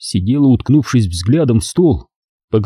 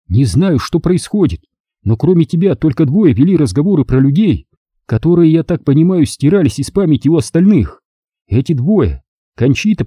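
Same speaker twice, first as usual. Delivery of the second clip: put-on troubled older woman, higher pitch; 4 semitones higher than usual. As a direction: recall a puzzling loss gently. Leonora's voice.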